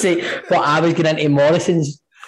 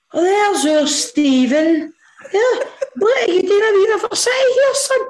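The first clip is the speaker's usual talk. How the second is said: put-on much higher, slow, sing-song old granny calling out; granny's delighted voice; slower than usual. Granny delighted to find her grandson filming at Morrison's. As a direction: coo it like a thrilled granny spotting her grandson. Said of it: "my granny's like".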